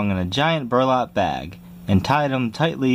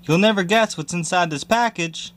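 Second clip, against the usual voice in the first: shouting; 7 semitones higher than usual. Acted.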